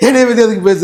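amused, laughing retort. Real, not acted. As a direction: laugh through the question. Laughs while dismissing his rival.

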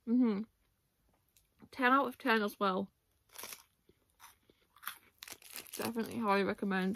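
chewing while talking; talking between bites; mouth full. Real, not acted. Seen 2: Bites and chews bar throughout; mouth full while talking.

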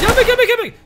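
high urgent orders to fall back; urgent callout. Real, not acted. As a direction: shout the team back. Enemy spotted, ordering team back.

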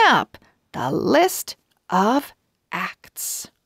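bright storybook reading with lively pauses; reading to children. A narrator's reading. Host reading a chapter to young viewers, storybook narration. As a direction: read it like a picture book for little listeners.

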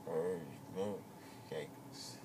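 very slow, drowsy mumble; drawn out, still sedated; groggy after anesthesia. Real, not acted. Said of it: "still waking up from your twilight sleep".

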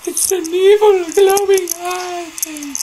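high, slow eerie storyteller voice; spooky voice. Pretend. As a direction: make it sound eerie and drawn out. Spooky description of monster eye.

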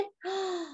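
a sharp, breathy gasp of pretend awe; mock gasp. Reading to a child, gasping at the acrobat sisters. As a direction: gasp as if the stunt amazes you. Reader gasps at sisters' pyramid.

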